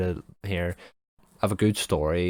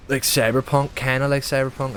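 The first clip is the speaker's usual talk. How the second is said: louder and higher, a burst of gamer excitement; excited outburst; 6 semitones higher, 5 dB louder than usual. Real, not acted. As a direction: blurt it out, suddenly fired up. Excited gaming talk outburst.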